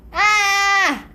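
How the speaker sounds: loud playful "aah" shout; demonstrated scream. Shown as a demonstration. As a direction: let out a held mock scream for the baby. (gritinho de brincadeira)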